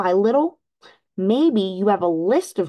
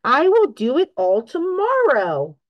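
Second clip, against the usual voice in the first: louder and higher, dragged out slowly; crying out; 5 semitones higher, 4 dB louder than usual. A narrator's reading. Toad cries out refusing all of Frog's chores. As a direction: wail it as a stubborn excuse. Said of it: "cried Toad".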